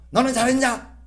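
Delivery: sharp high retort "너는 잘했냐?"; snapping back. Retold or imitated. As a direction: throw it back at the nagging spouse. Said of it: "이런 식으로 맞대어서".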